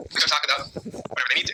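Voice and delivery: usual speaking voice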